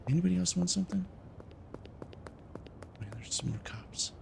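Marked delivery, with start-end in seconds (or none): 3.0-4.1 soft unvoiced whisper. Real, not acted. Gamer spotting more cops, whispering nervously. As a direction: breathe it so cops won't hear.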